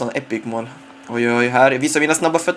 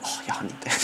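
disappointed whisper; 16 dB quieter than usual. Retold or imitated. Missing the celebration, hushed.

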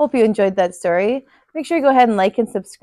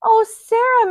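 much higher and louder, effusive gush; 13 semitones higher, 4 dB louder, slower than usual. A narrator's reading. Principal gushing as she peeks into the car, effusive. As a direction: greet her with over-the-top delight. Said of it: "Missus Burton gushed".

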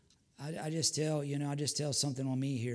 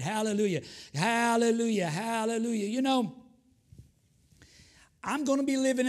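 rising, loud preacher cadence; fiery preaching; 6 semitones higher, 5 dB louder than usual. Acted. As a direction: preach it out to the congregation. Preaching at full force.

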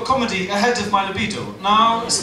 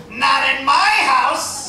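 slow, loud, higher American outrage; American indignation; 8 semitones higher, 6 dB louder, much slower than usual. Retold or imitated. Comic imitates an American, slow and loud. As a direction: drawl it like an offended American host. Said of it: "they'll go".

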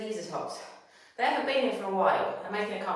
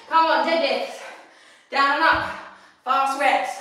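higher, louder push for fast reps; loud coaching; 4 semitones higher, 6 dB louder than usual. Real, not acted. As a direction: drive the class on with energy. Coach pushing the class harder.

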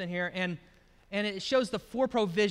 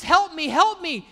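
acted panicked plea, higher and louder; slow, loud mock cry for help; Peter freaking out; 6 semitones higher, 7 dB louder, slower than usual. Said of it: "Peter's freaking out".

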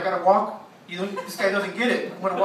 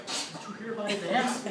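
clerk's hushed gossip; 11 dB quieter than usual. Retold or imitated. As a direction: pass it on quietly like a courtroom clerk. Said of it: "I see the lady, the clerk".

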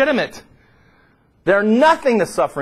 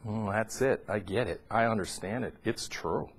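quiet inner voice; 6 semitones lower, 12 dB quieter than usual. Retold or imitated. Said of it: "I draw all the strings and I go".

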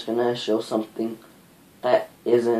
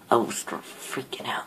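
hushed nervous whisper; 10 dB quieter than usual. Real, not acted. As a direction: drop to a whisper as if afraid. Explaining fear of the dark.

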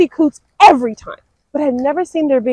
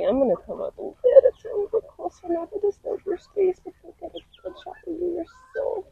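hushed cooing; 6 semitones higher, 7 dB quieter, faster than usual. Real, not acted. Birder creeping closer without disturbing their space, hushed cooing. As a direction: coo very softly so as not to startle them.

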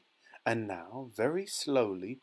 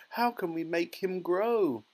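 high, pleading question; wailing; 8 semitones higher than usual. A narrator's reading. Mother begs Wonka to restore her son, wailing. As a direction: wail it helplessly, pitched up. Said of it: "wailed Missus Teavee".

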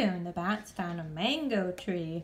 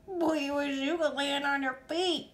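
wistful bird; 7 semitones higher than usual. Acted. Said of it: "Flitter said".

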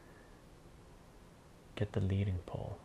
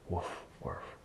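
softer, voiceless puffing woofs; grumpy bull snort; 5 dB quieter than usual. Acted. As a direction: huff like an irritable bull. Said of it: "Bull's grumpy".

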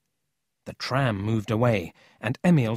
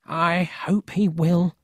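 slow, higher grandmother voice; worried grandmother; 6 semitones higher, 3 dB louder, slower than usual. A narrator's reading. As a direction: draw it out with concern. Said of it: "she was worried about something".

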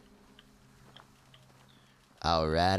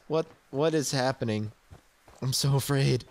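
ordinary talk

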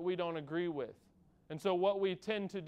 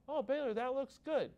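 put-on high sing-song praise for a child; gushing kindly to a little kid; sweet encouragement; 8 semitones higher than usual. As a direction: model being nice, pitched way up. Dad models kind praise to son.

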